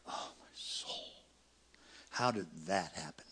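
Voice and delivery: a pastor whispering in horror; shocked whisper. Retold or imitated. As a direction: breathe it out in shock.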